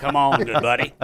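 laughing trucker on the CB. Pretend. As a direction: sign off like a CB trucker. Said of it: "Breaker, breaker".

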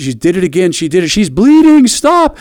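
high, loud panicked yell; frantic scream. Retold or imitated. Wife screaming for her husband in a crisis, high frantic yell.

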